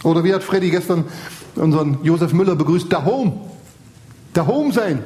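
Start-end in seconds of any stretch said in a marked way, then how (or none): 2.9-5.0 imitated Bavarian "dahom"; a touch of Bavarian accent; Bavarian dialect. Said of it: "Sollte bayerisch sein".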